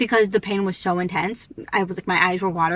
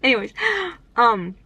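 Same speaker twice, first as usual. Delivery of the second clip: ordinary talk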